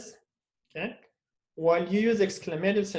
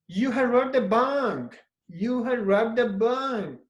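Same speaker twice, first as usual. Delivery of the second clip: "You had robbed the bank" said higher, as an exclamation; exclamative; 4 semitones higher than usual. Shown as a demonstration. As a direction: exclaim it in disbelief. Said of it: "then you change it to exclamative".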